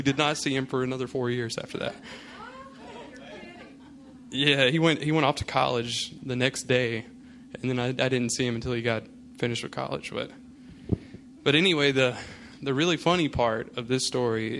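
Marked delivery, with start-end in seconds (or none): none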